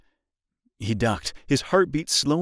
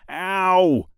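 pained yelp; 8 semitones higher, 6 dB louder than usual. A narrator's reading. Boy hurts himself doing splits.

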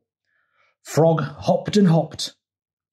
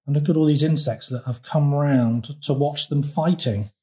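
usual speaking voice

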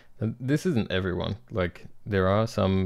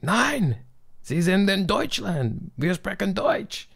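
much higher, slow, stern mock German bureaucrat yelling; screaming German official; 12 semitones higher, 3 dB louder, slower than usual. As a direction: ham up a strict official barking back in German. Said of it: "flat out will scream at you".

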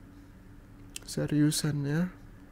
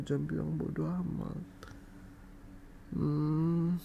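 ordinary talk, nothing marked

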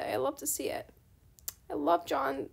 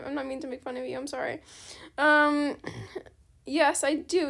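ordinary talk, nothing marked

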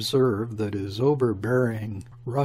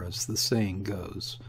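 soft unvoiced whisper; whispered aside; 3 semitones lower, 6 dB quieter than usual. Muttered aside in quotation.

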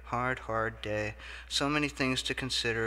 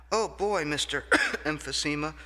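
much higher and louder thrilled cry; delighted exclaim; 5 semitones higher, 6 dB louder than usual. Acted. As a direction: cry out in delight through a cough. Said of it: "Mister Story exclaimed".

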